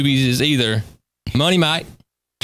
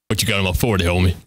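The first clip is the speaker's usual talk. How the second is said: lightly low bully; 11 semitones lower, faster than usual. Retold or imitated. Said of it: "He said".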